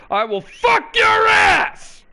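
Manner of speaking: huge, high drawn-out scream; Joe Cronin quote. Retold or imitated. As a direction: bellow it slowly like a battle cry. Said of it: "as the great Joe Cronin said".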